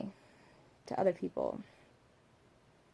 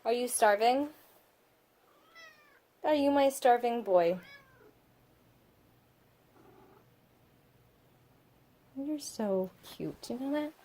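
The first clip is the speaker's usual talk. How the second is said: pet talk to kitten; 4 semitones higher, 10 dB louder than usual. Real, not acted. Talking to her kitten.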